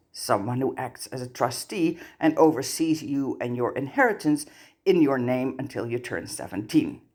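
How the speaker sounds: ordinary delivery, nothing marked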